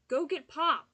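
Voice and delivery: loud, high yell to the house. Acted. Boy on the phone yelling for his grandfather, loud high call. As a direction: call out across the house at full voice. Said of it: "he yelled".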